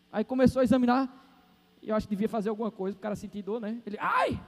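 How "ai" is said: high, short "ouch" yelp; mock yelp of pain. Patient prodded by the doctor, acted high yelp of pain.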